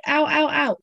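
mildly mimicked little yelps of pain; child in pain. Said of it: "holding her face".

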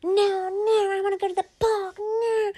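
put-on high, loud whiny yell of a child; screeching tantrum. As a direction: shriek it like a kid throwing a fit. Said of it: "start yelling and screeching".

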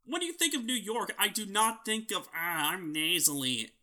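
usual speaking voice

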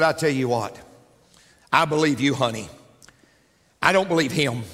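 low, soothing husband; gruff husband voice. Acted. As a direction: drop low and side with the wife. Husband voiced answering wife.

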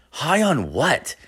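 mock bewildered query; acted disbelief. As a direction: draw it out, baffled by your friend. Questions his wired friend.

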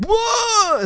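loud, high-pitched startled yelp; imitated startled whoa. Mimicking a guy hit by the spicy beer, loud startled yelp. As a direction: mimic a jolt at the heat.

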